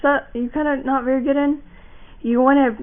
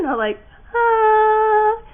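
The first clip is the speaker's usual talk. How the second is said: high, slow, whining ah; whiny ah; 10 semitones higher, 4 dB louder, much slower than usual. Shown as a demonstration. Wrong way to hit the note, whiny and slow. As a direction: whine the note like a complaint. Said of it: "Not, not try to be whiny".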